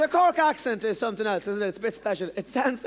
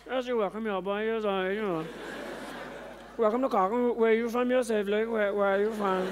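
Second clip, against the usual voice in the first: put-on Cork accent. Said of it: "the Cork accent".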